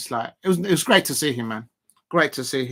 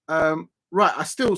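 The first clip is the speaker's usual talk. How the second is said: ordinary talk, nothing marked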